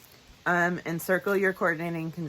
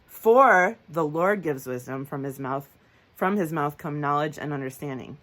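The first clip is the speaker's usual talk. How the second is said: AND and FOR hit loudly; emphasis on conjunctions; 6 dB louder than usual. Shown as a demonstration. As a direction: read the verses, stretching and punching "For". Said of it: "you'll hear me emphasize them".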